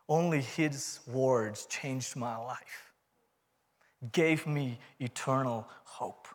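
hushed, heartfelt, with long pauses; solemn testimony. Real, not acted. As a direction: let each line settle, quietly sincere. Solemn testimony after applause.

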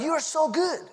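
high-pitched, imitated admirer; retold high, enthusiastic compliment; gushing praise. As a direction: mimic an amazed fan, voice soaring. Retelling customers' gushing praise.

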